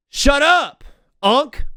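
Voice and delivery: high, loud mocking teen snap; bratty teen retort. Teen boys snapping back at the uncle, high and loud. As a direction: throw it back like a rude sixteen-year-old. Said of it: "they turned around and said".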